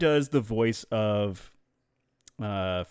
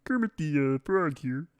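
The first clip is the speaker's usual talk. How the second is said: Kermit the Frog; 5 semitones higher, slower than usual. Shown as a demonstration. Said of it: "Sesame Street character".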